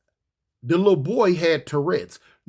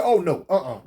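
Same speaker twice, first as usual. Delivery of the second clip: firm refusal; 3 semitones lower than usual. Retold or imitated. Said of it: "I said".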